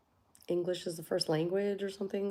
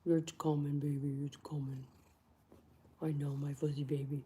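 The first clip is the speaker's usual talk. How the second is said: soft, lower cooing to the pet; soothing the cat; 4 semitones lower, 7 dB quieter than usual. Real, not acted. Calming her cat while waiting for the parcel, softly.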